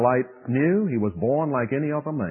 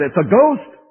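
higher, louder alarmed cry; frightened cry; 7 semitones higher, 9 dB louder than usual. Retold or imitated. Disciples spotting a figure on the water, mock alarmed cry. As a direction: repeat the panicked "ghost", rising. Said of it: "precisely what they said".

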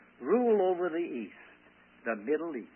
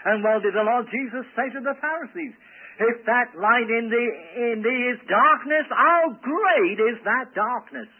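raised, loud scripture declamation; forceful preaching; 6 semitones higher, 5 dB louder than usual. Real, not acted. Preacher quoting Jesus's warning to the Pharisees, forceful preaching.

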